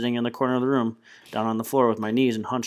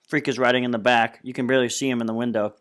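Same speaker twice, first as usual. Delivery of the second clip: plain reading voice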